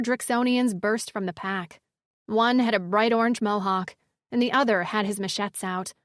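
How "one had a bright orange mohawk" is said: plain reading voice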